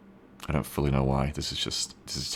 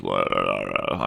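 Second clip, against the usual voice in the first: low creaky la la la; deep vocal fry; 12 semitones lower, 5 dB quieter than usual. Shown as a demonstration. Streamer demoing a deep fry voice for chat. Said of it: "like, a deep vocal fry".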